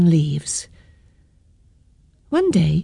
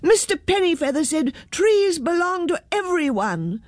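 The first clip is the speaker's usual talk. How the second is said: chorus it high, without real volume; storyteller's high mock shout; children's shout; 10 semitones higher than usual. A narrator's reading. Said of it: "shouted the children".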